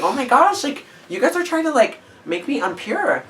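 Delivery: high, prissy faux-innocent voice; mock innocent. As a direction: play the pure little lamb.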